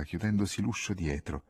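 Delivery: ordinary delivery, nothing marked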